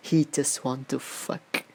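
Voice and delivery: each word slow and deliberate; dragged out disgusted quote; slow emphatic reading. Shown as a demonstration. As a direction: read it out slowly for weight. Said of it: "this is my headlight for this story".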